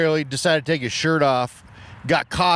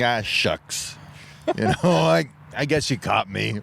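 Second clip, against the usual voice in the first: aw-shucks confession; 3 semitones lower than usual. Retold or imitated. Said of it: "He's kicking. Like".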